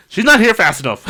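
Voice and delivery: high, loud panicked line then a laugh; freaking out waiting; panicky impatience. Retold or imitated. Impatient fear.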